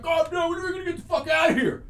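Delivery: high, rushed mock panic; retold panicked screaming. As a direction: imitate someone freaking out, fast and high. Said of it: "he starts panicking and fucking screaming".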